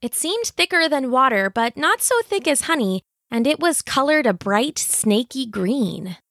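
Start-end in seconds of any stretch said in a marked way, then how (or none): none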